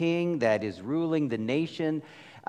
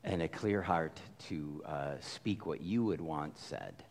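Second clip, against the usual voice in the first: quiet prayer; 9 semitones lower, 6 dB quieter than usual. Real, not acted. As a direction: pray gently before the sermon. Pastor leads opening prayer.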